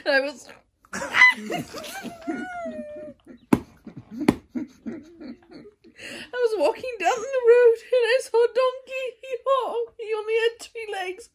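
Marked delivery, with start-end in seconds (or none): 0.9-3.6 real shrieking laugh; helpless laughter. Real, not acted. Cracking up mid-reading. 6.0-11.3 high, strained words through giggles; real fit of laughter mid-sentence; laughing while reading. Real, not acted.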